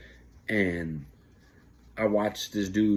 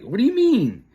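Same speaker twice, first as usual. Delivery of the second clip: baffled boss; 12 semitones higher, 7 dB louder than usual. Retold or imitated. Supervisor confused by answer.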